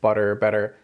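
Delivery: Butter, better with one quick tongue flap; flapped t. Shown as a demonstration. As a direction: flap the middle t once. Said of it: "Our tongue flaps against the roof".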